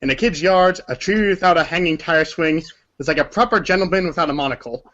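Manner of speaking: ordinary talk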